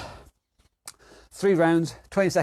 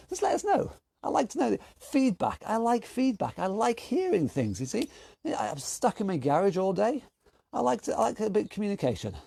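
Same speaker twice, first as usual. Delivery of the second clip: much higher, softer pleading voice; a touch of comic neediness; mock needy; 6 semitones higher, 4 dB quieter than usual. Real, not acted. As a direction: play the lonely guy in his garage. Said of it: "I go a little bit mad".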